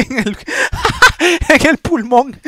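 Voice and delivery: words broken by laughter; laughing. Real, not acted. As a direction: laugh through the punchline. Laughing at own injury joke.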